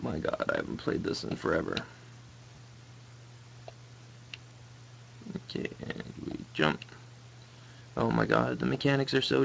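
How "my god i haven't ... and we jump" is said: soft whispered aside; whispering. Real, not acted. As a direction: whisper it to yourself. Whispered aside while playing.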